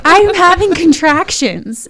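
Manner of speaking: slow, high, loud announcement; drawn-out loud retold cry; retold yell. Telling husband she's in labor, yelling slowly. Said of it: "I was, like, yelling at him".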